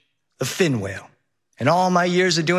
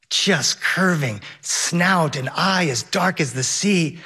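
ordinary delivery

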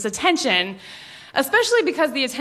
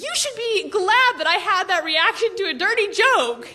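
much higher whiny pleading; pleading case; 9 semitones higher than usual. Retold or imitated. Daughter pleading her case to mom over dinner, high whiny plea. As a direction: plead like a wronged teen.

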